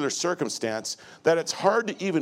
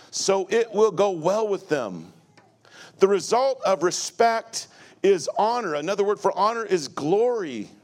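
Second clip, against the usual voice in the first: full-force preaching; 5 semitones higher than usual. Acted. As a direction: preach it out to the whole room. Preaching about honoring parents.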